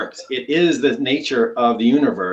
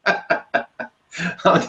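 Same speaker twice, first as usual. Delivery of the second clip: laughing; 6 semitones higher than usual. Real, not acted. Laughs right after the brogue joke.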